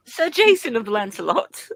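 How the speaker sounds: high, courtly British; British accent. Shown as a demonstration. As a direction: announce a knight in mock British. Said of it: "wants to see a British accent".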